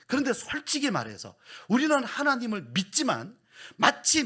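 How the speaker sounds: loud, high, impassioned sermon delivery; preaching at full force. Acted. Pastor pressing the congregation, loud and impassioned. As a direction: drive the point home to the pews.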